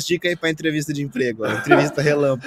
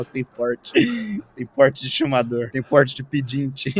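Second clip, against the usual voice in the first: slow mocking drawl; slower than usual. Mocking drawn-out repetition.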